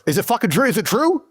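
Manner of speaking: high, rising, eager questions; can't believe the good news; excited disbelief. Real, not acted. [name] asks his lawyer if release is true, high and eager. Calls lawyer, hoping to go home.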